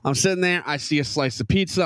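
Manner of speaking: normal talking voice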